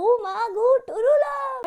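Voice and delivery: high, loud swooning cry; swooning squeal. Pretend. Girl gushing over her first true love, squealing. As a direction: squeal it like a lovestruck teen. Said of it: "मुझे ऐसा हो गया कि".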